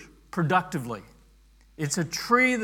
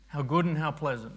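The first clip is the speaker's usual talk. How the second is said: quick, softer throwaway reading; casual skim; 2 semitones lower, 5 dB quieter, faster than usual. Shown as a demonstration. Preacher showing how readers glance through the verse, casual and quick. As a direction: glance over it as nothing special. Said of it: "we sort of casually go over it".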